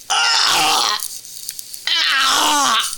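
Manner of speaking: drawn-out mock wail of a hurt child; imitated hurt cry. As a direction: imitate a kid crying out after a bump. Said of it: "I hear a thud and then, like, a".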